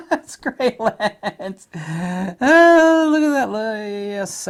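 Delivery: high, loud, slow gushing praise. Real, not acted. Reviewer gushing at sample photos, high and loud. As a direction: swoon over the lens out loud.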